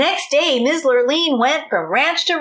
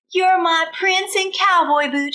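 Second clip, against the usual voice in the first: mild joyful shout; 6 semitones higher than usual. Acted. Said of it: "shouted Miss Lurleen".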